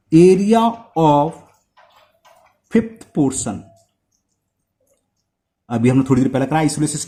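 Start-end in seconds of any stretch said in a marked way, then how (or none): 0.1-3.6 slow dictation. Real, not acted. Dictating while writing on board.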